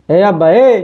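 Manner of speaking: son-in-law calling out rudely to his father-in-law; calling out loud. Acted. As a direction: shout to get the father-in-law's attention.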